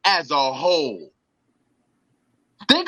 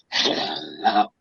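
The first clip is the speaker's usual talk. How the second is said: very low, growly mock boss hello; imitating the boss as Gargamel. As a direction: drop way down like a cartoon villain. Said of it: "does not sound like Gargamel".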